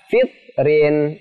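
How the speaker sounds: "fitrin" with t held and a gap before rin; wrongly pressed t. Shown as a demonstration. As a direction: stop dead on the t of "fitrin", no bounce.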